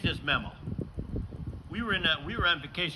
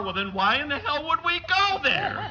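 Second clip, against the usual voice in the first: mock indignation; 11 semitones higher than usual. Indignant comeback, no bar.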